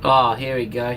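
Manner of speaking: usual speaking voice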